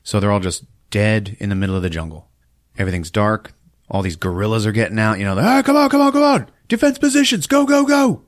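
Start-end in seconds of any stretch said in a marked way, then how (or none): 5.5-8.2 high, loud strained urgency; rally the troops in a high yell; mock battle yell. Guerrillas scrambling to defence positions, high mock battle yell.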